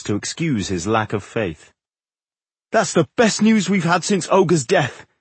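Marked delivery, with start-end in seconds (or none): 2.7-5.1 high, cheery and lively; cheerful. A narrator's reading. Dwarf welcomes the best news in a long while, cheerfully. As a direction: lift the voice with good cheer. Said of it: "he said cheerfully".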